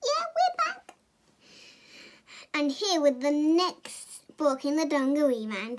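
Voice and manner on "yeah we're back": high squeaky sidekick voice; puppet voice. Acted. Sidekick puppet greeting the audience in a high squeak. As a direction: pipe up as a tiny puppet. Puppet character answers host.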